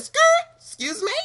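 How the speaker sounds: high, loud outraged squawk; indignant outburst. Retold or imitated. Mom snapping back at someone lecturing her. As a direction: shriek it in offended disbelief. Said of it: "I said".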